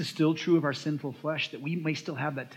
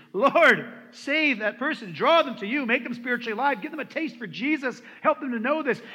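earnest intercession, raised and pleading; fervent prayer; 5 semitones higher, 4 dB louder than usual. Acted. Pastor modelling prayer for the unbeliever, fervently pleading. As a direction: cry out to God for the lost.

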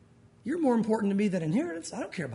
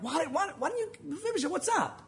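mad king; 7 semitones higher, 5 dB quieter than usual. Pretend. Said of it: "I don't know if it was mad".

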